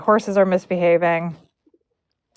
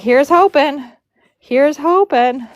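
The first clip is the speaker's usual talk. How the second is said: high, lilting "here's hoping"; retold nervous hoping; 7 semitones higher, 3 dB louder, slower than usual. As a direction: pitch it up, drawing out the repeat. Low on gas, retold hopeful refrain.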